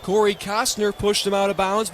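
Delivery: ordinary talk, nothing marked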